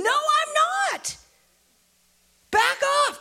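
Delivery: very high, agitated outburst; defensive yelp, warding someone off; heated denial. Acted. Peter angrily denies the accuser.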